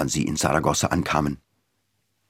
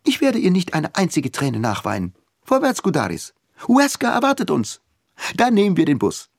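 defiant; 11 semitones higher than usual. A narrator's reading. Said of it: "Dann sagte sie trotzig".

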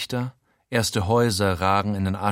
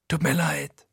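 higher, a touch sorry; 6 semitones higher than usual. A narrator's reading. Frankie apologizing to his robbed friend, sheepish. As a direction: say sorry, pitched up.

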